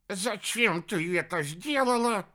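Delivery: high, slow, insistent demand. A narrator's reading. Хиша demands an explanation for the shrinking, demanding. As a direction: demand an answer in a high character voice. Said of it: "потребовал объяснений".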